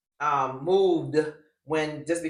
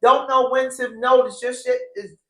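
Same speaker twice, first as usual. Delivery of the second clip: higher and louder, worked up; heated rant; 7 semitones higher, 7 dB louder than usual. Real, not acted. Ranting at the cast member she thinks is lying, worked up and loud. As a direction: call out the liar with heat.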